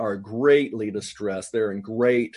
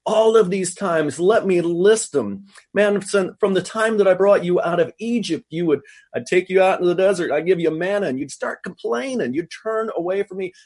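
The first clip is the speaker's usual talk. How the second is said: exasperated rant, raised pitch; fed up; 5 semitones higher, 4 dB louder than usual. Acted. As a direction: list the grievances like someone who has had enough. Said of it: "he is so fed up with them".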